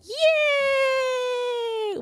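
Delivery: high squealed cheer; high cute anime girl. Acted. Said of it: "play pretend as Emma Nagorl".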